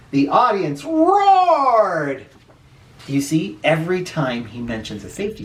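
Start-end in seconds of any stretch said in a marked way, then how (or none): 0.1-2.3 drawn-out, rising delight on roared; slow gleeful read; excited. A narrator's reading. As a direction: stretch it slow and high like a roaring crowd. Narrator savours crowd roar.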